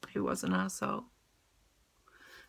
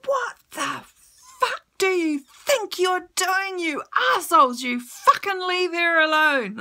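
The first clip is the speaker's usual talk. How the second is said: yelling at the attackers, louder and higher; angry shout; 7 semitones higher, 5 dB louder than usual. Retold or imitated. Man in a wheelchair yelling at thugs to stop, furious shout. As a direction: bellow at them to back off. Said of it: "he was shouting".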